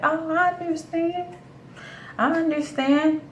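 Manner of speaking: high-pitched polite agreement; placating. Retold or imitated. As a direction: go up high and soft to soothe him. Placating the angry date.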